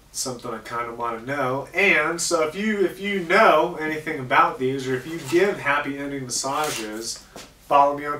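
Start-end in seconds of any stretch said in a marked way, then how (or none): none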